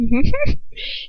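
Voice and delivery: high bright burst of laughter at a weird word; amused laugh. Real, not acted. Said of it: "Dirtily. That's a weird word".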